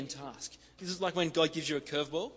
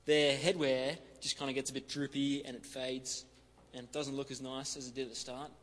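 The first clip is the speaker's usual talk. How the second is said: ordinary delivery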